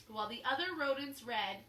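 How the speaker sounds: ordinary delivery, nothing marked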